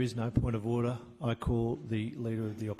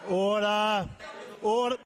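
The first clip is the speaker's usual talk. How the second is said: loud raised call to order; shouting order order; 10 semitones higher, 4 dB louder, slower than usual. Real, not acted. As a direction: bark order over the noise. Speaker calls the House to order.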